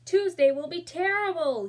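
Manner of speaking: high, loud fretting mother; mother's high anxious voice on the phone; mom on the phone. Acted. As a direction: wail it like a worried mom. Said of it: "she said".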